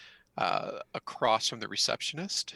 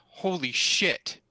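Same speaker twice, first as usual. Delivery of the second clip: louder, higher, drawn-out exclamation; startled outburst; 6 semitones higher, 6 dB louder, slower than usual. Acted. Game master voicing someone overheard from the office, startled outburst. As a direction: cry out in slow-dawning shock. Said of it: "you can hear a voice goes".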